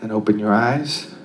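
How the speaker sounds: calm, unhurried guided meditation voice. Acted. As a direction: guide them gently into stillness.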